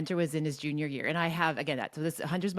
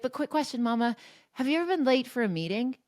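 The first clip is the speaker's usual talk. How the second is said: higher, a touch faster, probing; a lightly voiced son catching mom out; mild mock of a teenager's gotcha; teen son's pointed question; 7 semitones higher, faster than usual. Voicing her son's pointed question.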